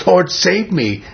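imitated desperate cry, pitched high; high, dead-serious retold plea. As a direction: act out a man pleading to be rescued. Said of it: "This guy was desperate".

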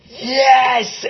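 high, drawn-out triumphant "yes"; elated inner cheer. Retold or imitated. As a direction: celebrate it like a silent cheer voiced aloud. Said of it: "I didn't say yes out loud".

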